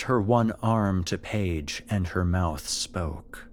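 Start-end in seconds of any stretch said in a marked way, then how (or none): none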